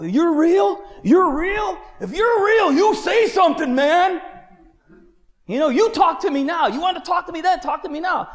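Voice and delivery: high, loud challenge to God; crazy-guy ranting. Retold or imitated. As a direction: holler at the sky, demanding an answer. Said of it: "I'm like one of these crazy guys".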